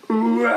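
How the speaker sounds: drawn-out storybook beast roar; monster roar. Acted.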